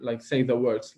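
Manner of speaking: ordinary talk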